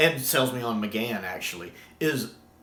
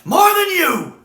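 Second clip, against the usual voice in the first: high, loud, fierce yell; defiant shout; 12 dB louder, slower than usual. Retold or imitated. Fan shouting McGann's favorite line back, fierce and high.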